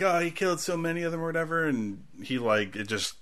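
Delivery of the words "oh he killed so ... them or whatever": put-on higher, quick kid voice; lightly voiced child, pitched up; imitated kid. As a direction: mimic a kid piping up. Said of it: "And he's like".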